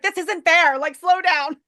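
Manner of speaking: imitating an exasperated pursuer; mock protest. Retold complaint during a chase.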